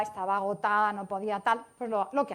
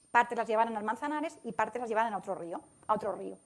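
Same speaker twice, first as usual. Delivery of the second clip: normal talking voice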